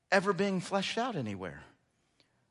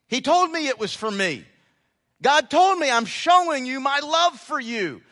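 full-force preaching; 7 semitones higher, 7 dB louder than usual. Acted. Pastor pressing scripture on the congregation, fervent preaching. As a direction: hammer the command home.

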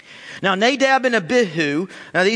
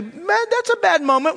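much higher, a startled yelp of emphasis; high exclamation; 10 semitones higher than usual. Real, not acted. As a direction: squeak it out in mock alarm. Fire from heaven zaps you.

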